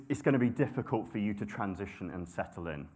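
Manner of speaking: normal talking voice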